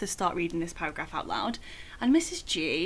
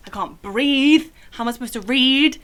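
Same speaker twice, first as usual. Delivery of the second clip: much louder and higher, pleading; panicked outburst; 6 semitones higher, 12 dB louder than usual. Retold or imitated. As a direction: cry it out as if panicking again. Retelling panic, unable to read.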